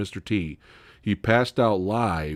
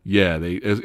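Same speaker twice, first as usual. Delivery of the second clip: ordinary talk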